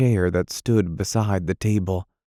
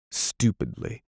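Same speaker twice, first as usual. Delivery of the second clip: plain reading voice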